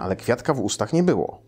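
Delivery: usual speaking voice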